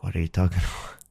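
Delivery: breathy stunned whisper with a laugh; whispered disbelief. Retold or imitated. Whispering in disbelief at the roommate's stem cell idea.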